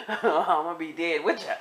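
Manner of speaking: breaking into laughter mid-plea; laughing plea. Retold or imitated. Telling crying inmate to stop talking, laughing nervous plea. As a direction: beg her off, laughing through it. Laughing at her own predicament.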